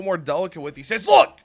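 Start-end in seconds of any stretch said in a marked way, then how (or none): 1.1-1.4 sharp high opening, then firm impatience; Tony D'Angelo, a little aggressive. Acted. Boss answers his questioning ally, a little aggressive. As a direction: push back a bit testily at a friend. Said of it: "a little aggressive".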